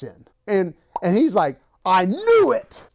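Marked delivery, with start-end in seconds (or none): 1.8-2.8 high, loud, drawn-out I knew it; gotcha shout. Retold or imitated. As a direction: crow it like you caught him. Thomas catches him out.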